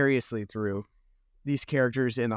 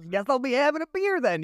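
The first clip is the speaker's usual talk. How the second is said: higher, lightly put-on resigned voice; mock resigned tourist; 11 semitones higher than usual. Tourist giving in to the pub menu, higher mock resigned voice. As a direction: shrug it off like a resigned visitor.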